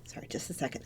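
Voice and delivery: real whispered aside while gathering herself. Real, not acted. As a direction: whisper it off to the side.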